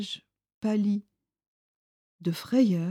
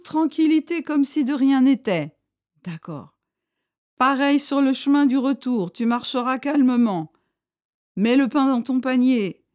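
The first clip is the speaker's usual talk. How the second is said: louder, higher stern warning; chief's voice; 5 semitones higher, 5 dB louder than usual. A narrator's reading. Chief warns Bardot to swear secrecy, louder and higher.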